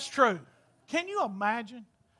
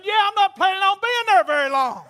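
punchline quote; 7 semitones higher, 7 dB louder than usual. Retold or imitated. Retold punchline to cemetery lady.

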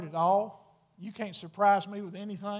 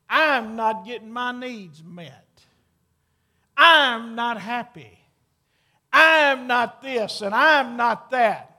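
mocking complaint; 7 dB louder, slower than usual. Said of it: "the word "I".

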